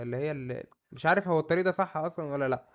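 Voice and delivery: ordinary delivery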